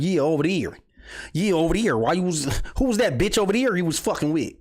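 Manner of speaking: put-on a light Los Angeles South Central accent, tossing the question off. Streamer imitating South Central LA women, light LA accent. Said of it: "it's hard to do L A shit".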